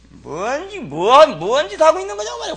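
high, loud scolding outburst; scolding roar. Retold or imitated. Pastor roaring at the church sister, a loud scolding. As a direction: bellow the rebuke at her. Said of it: "호통을 막 치면서 말이야".

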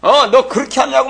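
imitating an angry father shouting at his kids; mock scolding. Father yelling at his children, imitated angry shout. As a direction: bark it like a father losing his temper. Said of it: "화를 내고 막 야단을 치고 성질을 내고".

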